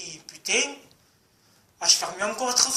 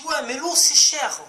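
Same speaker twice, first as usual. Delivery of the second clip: higher, louder mock woman's excuse; mimicking the woman; 6 semitones higher, 5 dB louder than usual. Mocking the woman's excuse about the price of water. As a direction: whine it like her flimsy excuse.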